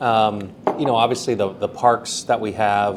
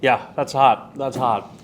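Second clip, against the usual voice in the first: talking through spicy heat; with heartburn; 3 semitones higher than usual. Real, not acted. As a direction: mouth burning from hot sauce. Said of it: "It's coming on like a freight train".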